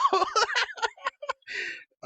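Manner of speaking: high, loud tearful laugh; a burst of laughter while holding back tears; emotional laugh. Real, not acted. Overwhelmed player laughs.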